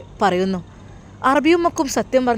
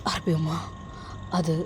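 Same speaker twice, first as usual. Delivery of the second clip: low, soft, hesitant plea; choked up, unable to go on; tearful; 5 semitones lower, 10 dB quieter than usual. Acted. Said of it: "എന്തിനാണ് നീ കരയുന്നത്". (കണ്ണീരോടെ മടിച്ചു മടിച്ച്)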